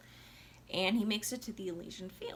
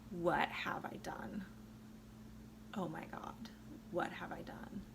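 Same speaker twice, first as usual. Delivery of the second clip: despairing whisper; 4 semitones lower, 9 dB quieter than usual. Retold or imitated. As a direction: whisper it as the horror sinks in. Orpheus after losing wife again.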